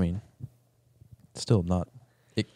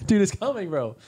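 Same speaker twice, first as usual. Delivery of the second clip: mock alarm; 11 semitones higher than usual. Warning about robot gun.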